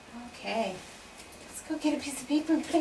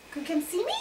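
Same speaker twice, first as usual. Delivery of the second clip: ordinary talk, nothing marked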